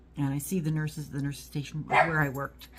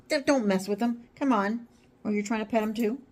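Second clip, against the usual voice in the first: higher-pitched talk to an animal; chiding pet talk; 6 semitones higher, 3 dB louder than usual. Real, not acted. Owner tells a pet not to mess with him, chiding. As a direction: gently tell off a pet. Talks to pets near each other.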